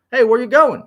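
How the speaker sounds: loud, high call down the hall; calling after. A narrator's reading. Bertha calls after Onyx striding past her, loud and high. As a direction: call after him as he walks off. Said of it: "she called".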